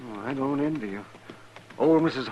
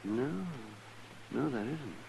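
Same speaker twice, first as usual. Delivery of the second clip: much softer, low and grave; hushed; 6 semitones lower, 13 dB quieter than usual. Acted. Doc seeing the body on the horse, hushed. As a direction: say it under your breath at the sight. Doc sees the dead man.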